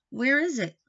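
ordinary delivery, nothing marked

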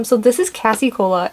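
ordinary talk, nothing marked

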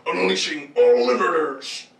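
slow, deliberate mechanical line; slow robot announcer. Acted. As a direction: drag it out like a machine. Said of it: "This one just goes like".

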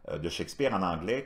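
ordinary delivery

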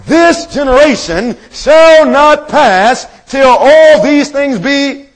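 high, slow, ringing preacher's delivery; proclaiming scripture. Real, not acted. Preacher quoting the Bible on this generation, proclaiming. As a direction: draw the verse out with mounting force.

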